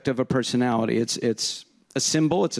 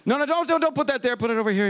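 much higher, urgent "don't put that there"; excited fuss; 11 semitones higher, faster than usual. Retold or imitated. Directing someone where to put something, high excited fuss. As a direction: fuss over where it goes, pitched way up. Animated instructions, pitch leap.

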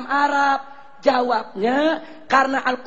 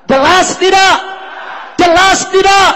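louder, higher each time; rising shout; 6 semitones higher, 9 dB louder than usual. Real, not acted. Preacher shouting the question at the crowd, building. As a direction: demand an answer from the crowd.

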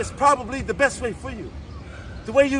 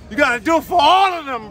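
shouting; 4 semitones higher, 4 dB louder than usual. Real, not acted. Coach firing up exerciser.

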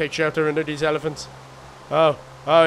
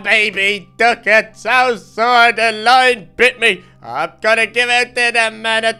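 mock whimpering; 4 semitones higher, 7 dB louder than usual. Whimpering after the bite.

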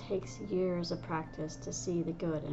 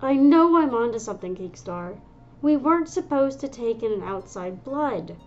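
loud, higher, stubborn claim; insistent shout; 5 semitones higher, 11 dB louder than usual. Acted. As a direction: push the point loudly. Medicine cat arguing with leader.

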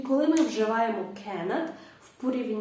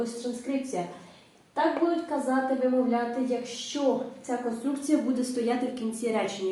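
ordinary talk

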